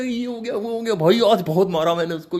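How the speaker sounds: high, hyped boasting; excited bragging. Retold or imitated. Telling his cousin about the fight, high excited bragging. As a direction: brag like a worked-up boy. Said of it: "ये वो तरीके से".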